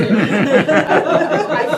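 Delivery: loud laugh breaking the words. Real, not acted. Laughing mid-sentence.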